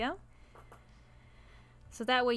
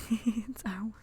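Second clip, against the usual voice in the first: whispering; slower than usual. Real, not acted. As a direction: breathe it out with a giggle. Whispered aside with laugh.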